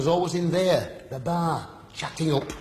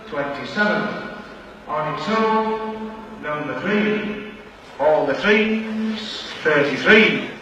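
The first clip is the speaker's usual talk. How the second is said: drawn-out sing-song number calls; bingo caller; 4 semitones higher, slower than usual. Real, not acted. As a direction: call the numbers across the hall.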